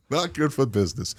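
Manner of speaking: chuckling softly through the words; laughing. Real, not acted. Host repeats his own joke to the guest, softly laughing. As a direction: laugh it out under your breath.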